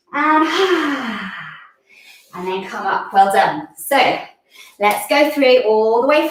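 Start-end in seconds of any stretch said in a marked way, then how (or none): 0.5-1.5 long falling "haah" sigh; audible exhale. Shown as a demonstration. Teacher ends the curled-up pose, audible exhale. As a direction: breathe out with a sighed haah. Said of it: "take a breath in".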